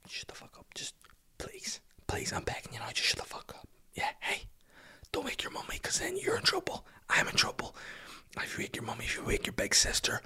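desperate, hushed whispered plea; whispered begging. Retold or imitated. Dad crouched at the cot pleading with the baby, whispering. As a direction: beg in a whisper so the house stays asleep.

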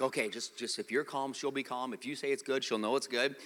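low, soft self-reassurance; trying to stay calm. Retold or imitated. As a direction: talk yourself down quietly. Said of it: "I'm trying to be the calm one".

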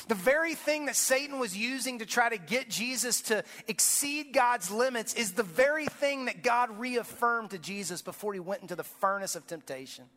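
ordinary talk, nothing marked

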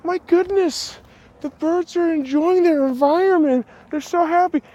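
high, breathy-sounding mock delight over birdsong; dreamy human. Humans marvelling at birds, in a high gushing voice. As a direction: gush like a charmed nature lover. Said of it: "you're like".

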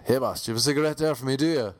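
put-on fast Irish Traveller accent; cheeky Traveller kid cadging a smoke; Irish Traveller accent, rattled off quickly; pikey accent. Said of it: "Yeah, it was kinda like that".

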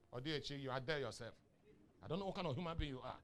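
ordinary delivery

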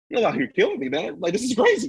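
high, plaintive mock complaint; pleading planet Earth. Voicing Gaia complaining to humans, high pleading voice. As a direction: protest in a high put-on voice. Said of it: "all powerful, but also, like".

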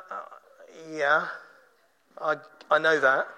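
low, hesitant acknowledgement; reluctant agreement. Acted. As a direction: concede it haltingly, pitch dropped. Acts out a half-comforted sufferer's reply.

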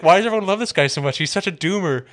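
loud, high comic rant; Bill Burr. Retold or imitated. Friend retelling a comedian's bit about worshipped scientist, high exasperated rant. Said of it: "I love the Bill Burr bit".

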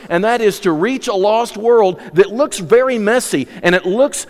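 high, loud, impassioned preaching; preaching at full force. Real, not acted. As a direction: drive it home to the congregation. Preaching climax.

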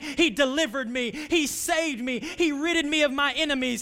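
fervent preaching prayer over music; impassioned prayer. Real, not acted. Pastor leading the church in prayer over music, impassioned. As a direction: pray it out with rising conviction, then settle.

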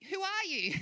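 high-pitched, indignant question; incredulous challenge. Acted. Preacher voicing James to planners, incredulous. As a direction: challenge them, pitched right up.

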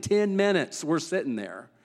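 ordinary talk, nothing marked

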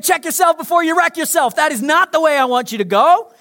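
loud, high, urgent warning from God; forceful warning. Acted. Preacher voicing God, loud and urgent. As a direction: call it out like a stern warning.

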